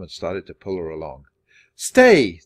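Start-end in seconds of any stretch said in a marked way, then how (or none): 1.7-2.4 one sharp louder order; loud command. A narrator's reading. Teacher ordering the leaving children, loud command. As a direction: stop them in their tracks. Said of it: "the teacher commanded".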